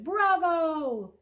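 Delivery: loud, high bravo; cheering shout. A narrator's reading. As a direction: cheer like a flock of canaries. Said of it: "the canaries shouted".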